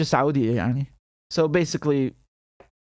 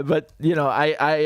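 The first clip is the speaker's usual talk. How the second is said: ordinary talk, nothing marked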